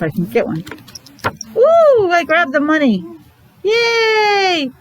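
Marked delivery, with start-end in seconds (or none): 1.6-3.0 high squeal of mock surprise, then bright; delighted surprise. Teacher to her young class, hammed-up surprised ooh. Teacher pulls a card for kids. 3.6-4.7 high, bright playful cheer. Acted. Teacher cheering with her young pupils, high playful yay.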